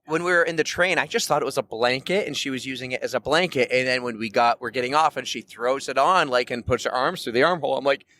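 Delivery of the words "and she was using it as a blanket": ordinary talk, nothing marked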